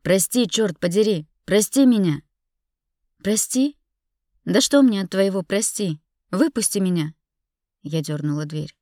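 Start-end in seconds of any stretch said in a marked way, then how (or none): 0.0-2.2 loud, exasperated apology; forceful outburst, building louder; yelling. A narrator's reading. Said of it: "заорал он". 3.2-3.8 high, loud rising question; indignant questioning repeat; incredulous echo. Acted. Throws his apology back at him. 4.4-7.1 loud, angry rejection; heated outburst in a raised voice; indignant demand. Acted. Rejects apology, demands to get out.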